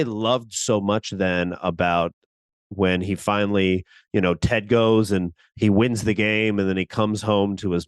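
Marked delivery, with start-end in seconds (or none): none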